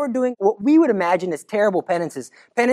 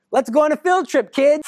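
Mary's invitation; 7 semitones higher than usual. Acted. Mary voiced inviting children.

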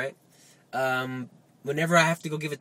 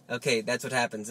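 normal talking voice

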